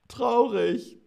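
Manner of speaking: sad word sung out high. Shown as a demonstration. Teacher acts sad in the example sentence. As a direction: let traurig rise high, mournfully. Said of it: "als nächstes haben wir traurig".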